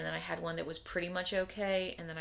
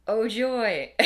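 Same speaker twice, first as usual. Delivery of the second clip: sarcastic oh joy; 5 semitones higher, 12 dB louder than usual. Real, not acted. Sarcastic remark about coming discomfort.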